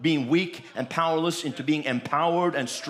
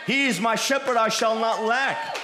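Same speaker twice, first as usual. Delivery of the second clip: bold proclamation; 7 semitones higher, 4 dB louder than usual. Acted. Preacher proclaims scripture with authority.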